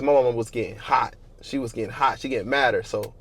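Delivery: normal talking voice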